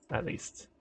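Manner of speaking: breathy whisper under the breath; whispered aside to himself. Real, not acted. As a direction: slip it in as a whispered afterthought. Muttered aside.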